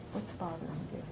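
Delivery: very soft, low, caring question; compassionate. Acted. Answering someone who yelled at you, softly compassionate. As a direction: ask gently, almost under your breath. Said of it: "Have compassion".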